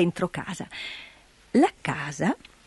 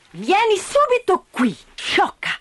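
curt scolding; 10 semitones higher than usual. Acted. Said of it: "tagliò corto Dete".

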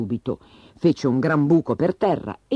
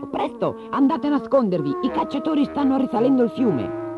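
much higher, urgent little bird voice; breathless alarm; 11 semitones higher than usual. A narrator's reading. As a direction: warn them in a panicked rush. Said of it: "arrivò trafelato un uccellino".

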